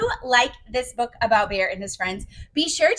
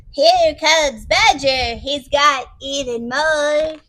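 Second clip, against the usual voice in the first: loud, slow announcing hare; 5 dB louder, much slower than usual. Acted. Hare pointing friends to the door, loud character voice. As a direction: call it out, stretching the words. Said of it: "says Hare".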